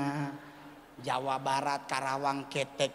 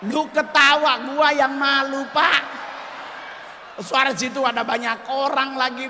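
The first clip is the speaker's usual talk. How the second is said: high, loud mock-ashamed wailing; embarrassed wailing; 8 semitones higher, 6 dB louder than usual. Comic tells the crowd how ashamed he was, high and loud. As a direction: moan it out in comic shame.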